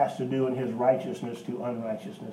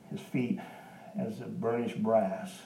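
ordinary delivery

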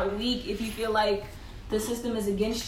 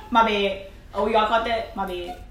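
ordinary talk, nothing marked

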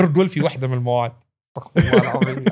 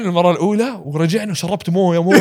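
ordinary talk, nothing marked

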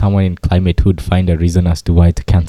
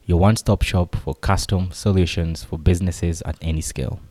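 quiet, even sponsor read; 8 dB quieter than usual. Acted. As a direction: read it like a podcast ad. Sponsor read at episode start.

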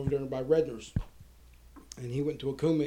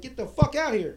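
raised, higher mock yell; retold scream; 8 semitones higher, 5 dB louder than usual. Calling Jimmy and Scott out to look, retold yell. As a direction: holler it out as a retold call. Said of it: "screaming for 'em".

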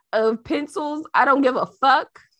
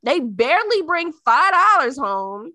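usual speaking voice

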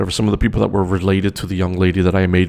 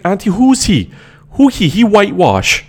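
high mocking Trinidadian voice, louder; mock Trini accent; 12 semitones higher, 5 dB louder than usual. Mocking the cousin asking auntie who he is, in a high Trini accent. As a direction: imitate the rude cousin in a thick Trini accent.

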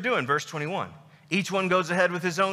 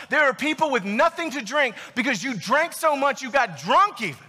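amped-up, emphatic scolding; indignant Paul; 3 semitones higher than usual. Acted. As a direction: hammer out the apostle's outraged disbelief. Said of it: "feel what Paul's feeling right now".

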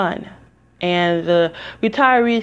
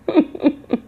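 laugh; 8 semitones higher than usual. Real, not acted. Amused laugh about sister.

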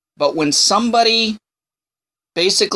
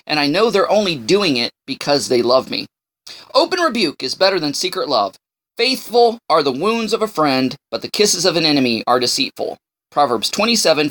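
ordinary talk, nothing marked

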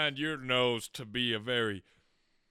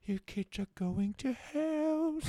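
mock scandalized mom; 9 semitones higher, slower than usual.

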